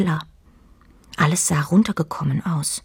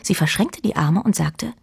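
plain reading voice

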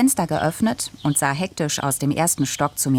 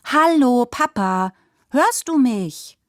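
calling out; 9 semitones higher, slower than usual. A narrator's reading. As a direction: call to get his attention. Calling to distracted Papa.

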